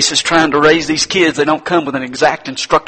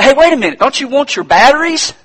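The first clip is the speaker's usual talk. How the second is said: high, loud call after someone walking off; 12 semitones higher, 7 dB louder than usual. Acted. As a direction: shout after him before he's gone. Inventor calls after the buyer.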